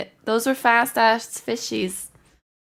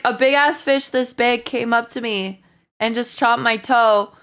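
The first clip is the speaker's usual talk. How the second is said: ordinary talk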